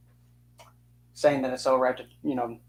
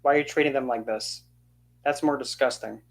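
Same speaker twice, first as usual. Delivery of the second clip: ordinary delivery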